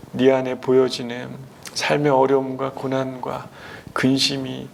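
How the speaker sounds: low, soft, unhurried reassurance; gentle encouragement. Acted. Jesus promising to lift Peter up, gentle encouragement. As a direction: comfort tenderly, pausing between phrases. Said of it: "격려하는 그 이야기로".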